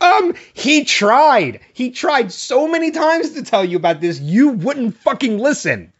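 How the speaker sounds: high, slow, incredulous outburst with a laugh; exasperated yelling at TV. Retold or imitated. Yelling at Grace on the TV in front of her mother, exasperated. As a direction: shout it at the screen, fed up. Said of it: "And I'm like".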